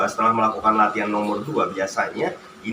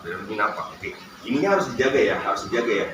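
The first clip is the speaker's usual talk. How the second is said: ordinary talk, nothing marked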